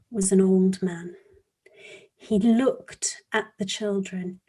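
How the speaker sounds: soft storytelling with long suspenseful pauses; hushed story narration. Acted. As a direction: draw children into a mystery, quietly and deliberately.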